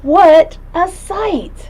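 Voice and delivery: high slow awe; slow high-pitched amazement; wonder. Acted. Marvelling at page.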